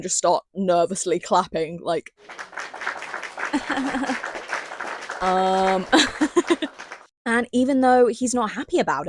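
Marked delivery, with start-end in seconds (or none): 2.3-5.2 laugh. Real, not acted. Laughing at the council scene. 5.9-6.9 laugh. Real, not acted. Laughing while retelling.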